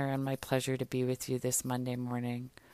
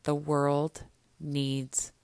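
drawn out, louder declaration; slow solemn declaration; 5 dB louder, much slower than usual. Shown as a demonstration. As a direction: stretch each word out. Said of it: "Breathe into that for a minute".

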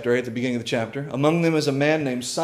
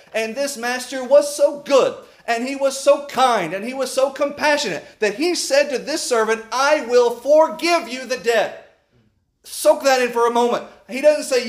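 higher, louder, driving sermon voice; full-force preaching; 9 semitones higher, 4 dB louder than usual. Acted. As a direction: preach it hard to the congregation. Preaching the parable with fervor.

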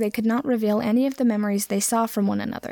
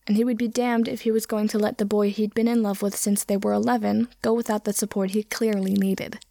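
usual speaking voice

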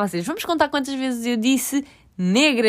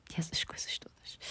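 soft breathy whisper with a laugh; 18 dB quieter than usual. Real, not acted. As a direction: drop to a whisper. Trailing off, whispered.

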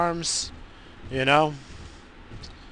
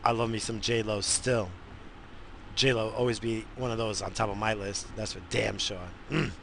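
ordinary talk

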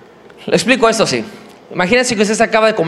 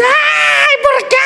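very high, crying shout of grief, hammed up; mock crying; much slower than usual. Owner sees her silk dress ruined, mock sobbing wail. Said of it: "y te lloras".

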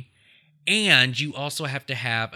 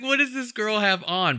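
mock disbelief; 11 semitones higher, 3 dB louder than usual. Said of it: "I was like".

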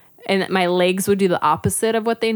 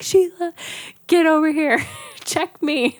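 urgent call; 10 semitones higher than usual. Retold or imitated. Demanding midwife check her.

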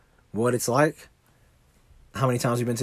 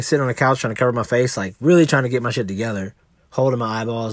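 ordinary delivery

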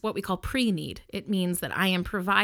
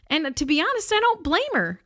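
emphatic aside; 9 semitones higher, 4 dB louder than usual. Real, not acted. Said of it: "emphatic personal aside".

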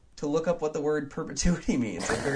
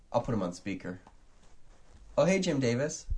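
ordinary delivery

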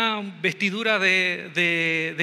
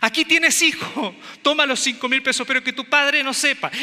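flustered mother; 5 semitones higher than usual. Retold or imitated. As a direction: rush to buy his silence. Mother paying the son off.

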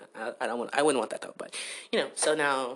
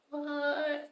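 high, startled yelp of a word; a mock-shocked squeak; shocked what; 12 semitones higher than usual. Son reacts to mom's question with a high startled squeak.

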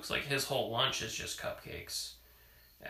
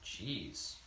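ordinary delivery, nothing marked

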